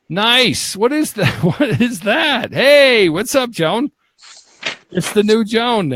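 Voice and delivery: high-pitched, delighted exclamations; high, stretched-out whoops of surprise; amused amazement. Real, not acted. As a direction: greet a startling sight with rising glee. Host amazed at guest's new look.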